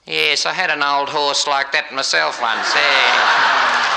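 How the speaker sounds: put-on old Aussie farmer's dry, loud comeback; old Aussie bloke. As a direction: shout the punchline as a laconic old Aussie. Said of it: "the old Aussie bloke said".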